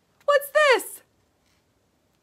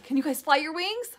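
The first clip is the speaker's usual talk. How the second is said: plain reading voice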